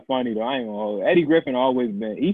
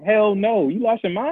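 incredulous; 9 semitones higher, slower than usual. Real, not acted. Friends arguing comedy rankings.

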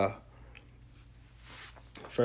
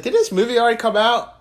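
high, loud incredulous question; loud mock confusion; retold disbelief; 11 semitones higher, 7 dB louder than usual. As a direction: act out your baffled reaction, pitched up. Retells own disbelief about Twilight.

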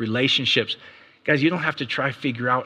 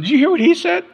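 very high, loud mimicked retort; indignant wife retorting; 7 dB louder than usual. Husband mimicking wife's comeback after counseling, indignant. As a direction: imitate a wife firing back, pitched way up. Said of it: "And she's going".

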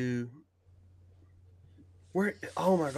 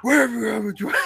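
loud, higher mock interrogation; Batman; 7 semitones higher, 5 dB louder than usual. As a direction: burst out louder, pretending to grab someone. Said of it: "And then he grabs him".

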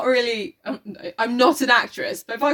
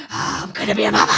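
raspy whisper; 5 semitones lower than usual. Acted. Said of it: "She rasped".

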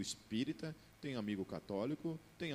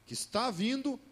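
dramatic peak; 12 semitones higher, 8 dB louder than usual. Acted.

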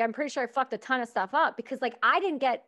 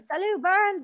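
higher-pitched mock French greeting; French accent; 8 semitones higher, 3 dB louder, slower than usual. Police greeting a soldier walking in, French accent. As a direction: imitate a Quebecois police officer saying hello. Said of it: "like, French".

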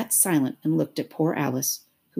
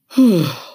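loud, heaving sigh; big woeful sigh; heavy sigh; 4 semitones higher, 10 dB louder than usual. A narrator's reading. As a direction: sigh loudly before speaking. Mock Turtle sighs before speaking.

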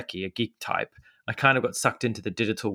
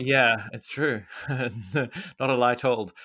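laughing; 2 semitones higher, much slower than usual. Real, not acted. Laughing insistence after disbelief.

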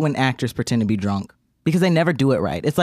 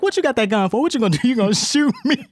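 high, laughing taunt; mock taunting; 10 semitones higher, faster than usual. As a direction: dare him to shoot. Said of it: "she's like".

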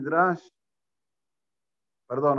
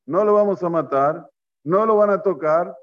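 emphatic, louder refusal; firm objection; 3 dB louder than usual. Acted. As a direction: put your foot down as the protective brother. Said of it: "¿Qué tiene que haber dicho Rubén?".

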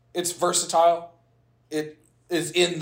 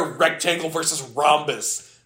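ordinary talk

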